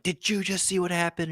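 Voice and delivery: high, excited rush; retold excitement. Landing and asking his buddy, high excited disbelief. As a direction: ask it wide-eyed and high. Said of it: "I'm telling my buddy, I'm like".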